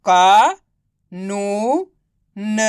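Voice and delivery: drawn-out का, नू, न with gaps between; syllable by syllable. Shown as a demonstration. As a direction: sound out का, नू, न one by one. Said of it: "तो मिलके बन जाएगा". (का, नू, न को अलग-अलग खींचकर)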